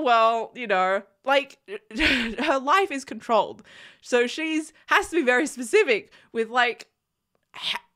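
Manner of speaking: ordinary talk, nothing marked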